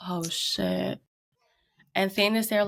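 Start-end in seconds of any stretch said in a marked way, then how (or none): 0.1-1.0 whispered, sinking reaction; hushed realisation. Retold or imitated. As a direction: whisper it as it dawns on you. Retold onlookers' dawning realisation.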